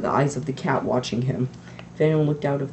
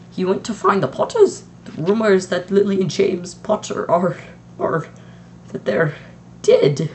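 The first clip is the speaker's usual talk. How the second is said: higher, louder, halting dread; McGonagall voice; 5 semitones higher, 4 dB louder than usual. A narrator's reading. Strict witch telling the headmaster the Potters died, halting dread. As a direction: stumble over the terrible news.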